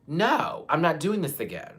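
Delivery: real fury at family; angry no. Real, not acted. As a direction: cut it off hard. Angry refusal.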